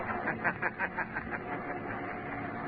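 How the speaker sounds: breathy sinister chuckle, soft and far off; whispery mocking cackle; eerie laugh. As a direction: laugh like a phantom in the dark. Shadow's unseen mocking laugh.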